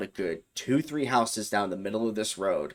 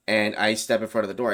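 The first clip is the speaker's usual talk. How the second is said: ordinary talk, nothing marked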